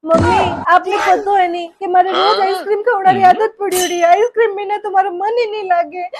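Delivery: loud crying whine. Acted. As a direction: sob out the complaint. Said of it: "पर तू रो क्यों रही है". (माँ से ज़ोर से रोते हुए शिकायत)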